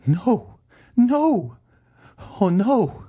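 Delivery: very high, loud cries of dread; high-pitched, panicked protest; horrified refusal. Acted. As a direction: wail each refusal in a high woman's voice.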